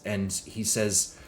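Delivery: normal talking voice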